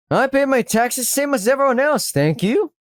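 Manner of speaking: high, prim indignation; politely refuse, pitched high; high-voiced affronted shopkeeper; affronted rebuff.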